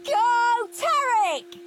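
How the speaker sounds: high, loud cheer for his hero. A narrator's reading. Henry cheering Tornado Tarek on TV, a high loud cheer. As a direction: yell it like a fan at the telly. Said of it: "cheered Henry".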